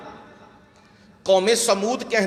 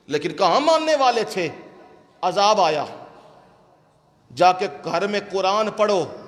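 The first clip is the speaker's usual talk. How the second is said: usual speaking voice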